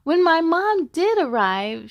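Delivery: ordinary delivery, nothing marked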